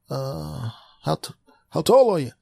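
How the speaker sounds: high, chirpy doctor voice; high cheerful doctor asking questions; high mock doctor. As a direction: breezily quiz the caller.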